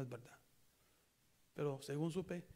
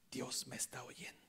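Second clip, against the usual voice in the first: whisper; 4 semitones lower, 5 dB quieter than usual. Real, not acted. Whispered personal assurance.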